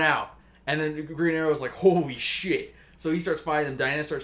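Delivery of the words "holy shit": drawn-out imitation of awe; stunned exclamation. Green Arrow reacts to soldiers pouring out, stunned. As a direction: stretch it out in mock amazement.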